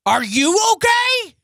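high, loud, slow mock growl of a question; imitating the irate driver; angry old man. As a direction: throw the question back, hammed up. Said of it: "he goes".

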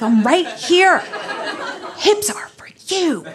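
exasperated comic call-out; mock indignant call. Dancer to boyfriend looking elsewhere, mock indignant.

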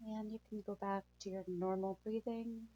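calm soothing guide through breathing; guided meditation. Acted.

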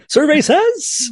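high, slow drawn-out survey call; game show host. Pretend. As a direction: announce it like a Family Feud host. Family Feud host call.

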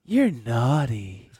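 slow, drawn-out sultry put-on voice; Jennifer Coolidge impression. Friends doing Jennifer Coolidge in a slow sultry voice. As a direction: purr it slowly like a vamp.

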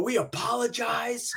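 high, slow mock-solemn announcement; imitated apologetic parent. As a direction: mimic a parent apologizing into the mic. Parent takes mic, apologizes to audience.

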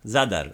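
Zadar stressed on ZA, dar falling away; stress on first syllable. Shown as a demonstration. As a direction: model it: hit ZA, let dar fall. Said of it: "accent on the first syllable".

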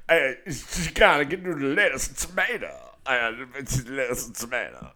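high, drawling goofy voice; silly put-on voice. Answering partner who wants the voice stopped, silly voice. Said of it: "So that's my new voice right now".

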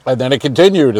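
ordinary delivery

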